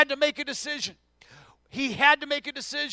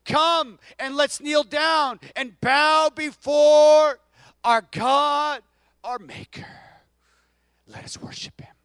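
slow reverent invitation fading to a hush; psalmist's call; 3 semitones higher, 6 dB louder, slower than usual. Acted. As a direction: kneel down with it, then breathe the last line. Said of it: "That's why he said".

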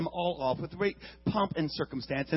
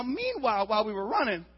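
ordinary delivery

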